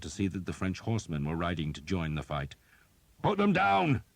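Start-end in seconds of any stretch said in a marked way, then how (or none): 3.2-4.0 high, loud battlefield bellow; shouted order. A narrator's reading. Commander ordering archers to shoot, a loud high bellow. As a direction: yell the order across the river. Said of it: "Skeet shouted".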